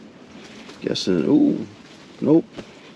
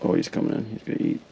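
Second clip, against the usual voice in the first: whispering; 5 semitones lower, 6 dB quieter, faster than usual. Real, not acted. Fish approaching the bait.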